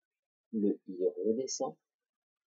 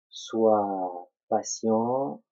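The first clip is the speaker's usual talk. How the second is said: very drawn-out words, spaced apart; slow, emphatic advice; 5 dB louder, much slower than usual. Real, not acted. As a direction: stretch each word to urge patience. Teacher's slow closing advice to pupil. (très lent, en insistant)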